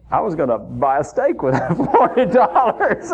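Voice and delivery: laughing through the words, sheepish confession. Real, not acted. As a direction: chuckle while admitting the plan to the Lord. Confessing steak plans to the Lord.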